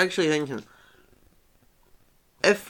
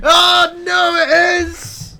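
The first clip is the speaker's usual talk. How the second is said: mock outraged cry; 8 dB louder, much slower than usual. Pretends to be hurt at being corrected.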